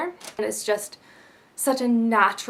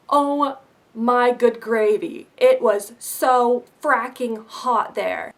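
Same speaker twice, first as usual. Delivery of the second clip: exasperated exclamation; 6 dB louder, slower than usual. Real, not acted. Exclaiming about the Texas heat.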